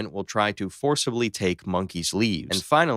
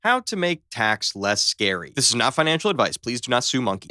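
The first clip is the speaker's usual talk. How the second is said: normal talking voice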